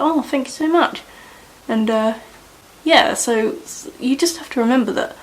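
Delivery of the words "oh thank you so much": higher, bright thankful reply; thanking the customer, higher and grateful; grateful thanks. Retold or imitated. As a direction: sound touched and thankful. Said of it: "I was like".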